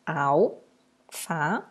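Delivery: "Alface" broken up, a pause after each syllable; split into syllables. Shown as a demonstration. Teacher showing how to separate the word, "Alface" syllable by syllable. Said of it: "Como que eu separo?".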